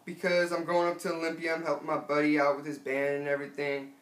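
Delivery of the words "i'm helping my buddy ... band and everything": ordinary talk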